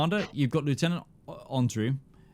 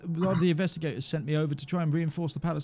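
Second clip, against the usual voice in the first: higher, fast nervous babble; flustered guard; 5 semitones higher, faster than usual. Acted. Guard explaining himself to Ruby, high and rushed. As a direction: stammer out the excuse in a rush.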